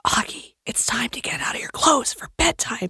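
soft unvoiced whisper to a child. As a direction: whisper it gently like a mom at bedtime. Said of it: "I would be whispering".

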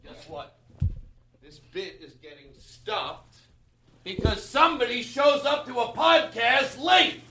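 slow distant start building to a high holler; faraway shouted reprimand, rising high; mock scolding. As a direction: stop the bit with a mock yell. Mock scolding that stops the bit.

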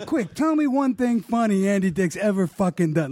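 retold jealous tantrum; obsessed fury.

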